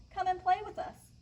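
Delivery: high, eager mock call. Fish friends want Rainbow Fish to play, calling out. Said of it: "they called".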